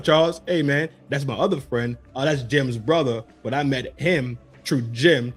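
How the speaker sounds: lightly voiced street guy naming friends; dude listing friends. Mocking a grown man introducing friends, low and laid-back.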